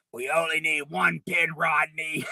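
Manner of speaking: deep, low imitation of the ad announcer; commercial voice. Recalling an old commercial for his friend, deep mock announcer. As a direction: drop the voice way down and boom the ad line.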